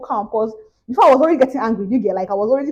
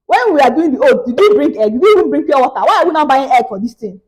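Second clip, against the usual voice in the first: mock outrage; 3 semitones higher, 5 dB louder than usual. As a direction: fire questions at him, louder.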